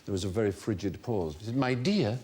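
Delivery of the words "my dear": affronted, refined Scottish lady; frosty, refined Scottish accent; refined Morningside accent. Retold or imitated. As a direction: imitate an insulted Edinburgh Morningside matron. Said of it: "that very refined Scottish accent".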